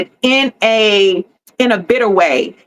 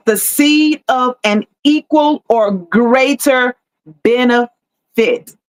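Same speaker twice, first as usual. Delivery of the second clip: slowed repeat. Shown as a demonstration. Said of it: "I believe I'll say that again".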